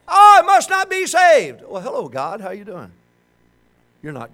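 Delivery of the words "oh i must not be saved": loud, high, drawn-out cry of doubt; mock despairing wail. Mocks a fallen believer's despair.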